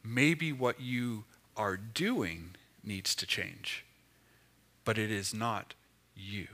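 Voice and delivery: soft, low and slow reassurance; quiet, reassuring inner voice; gentle Holy Spirit. Pretend. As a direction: speak it tenderly, like comfort to a weary friend. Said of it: "the Holy Spirit broke in and said".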